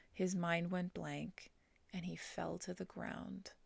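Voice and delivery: usual speaking voice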